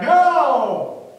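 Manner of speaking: loud, high drawn-out shout; big acted yell back to shore. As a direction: holler the answer across the water. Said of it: "They yell back".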